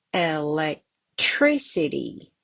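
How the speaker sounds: Electricity, slow, with TRI stressed; elecTRIcity, teacher's slow model; stress on third syllable. Shown as a demonstration. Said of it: "On the third syllable".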